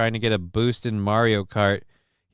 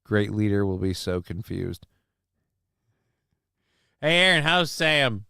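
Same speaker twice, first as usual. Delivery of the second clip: normal talking voice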